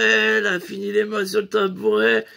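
ordinary talk, nothing marked

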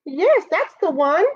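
high-pitched little badger; small animal voice, pitched well up; badger character. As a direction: answer in a high, insistent badger voice.

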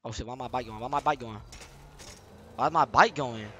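usual speaking voice

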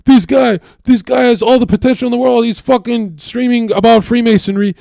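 fast, high, loud screaming rant; screaming dad. Retold or imitated. Dad on the phone screaming at the twin, loud and furious. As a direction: tear into him for kicking his brother out. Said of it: "he started screaming at him".

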